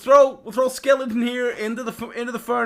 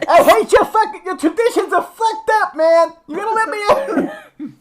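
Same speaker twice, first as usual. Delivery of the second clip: high, loud muffled shouting from inside; muffled yell; 12 semitones higher, 5 dB louder than usual. Acted. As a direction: yell trapped inside a furnace. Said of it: "hear his muffled voice like".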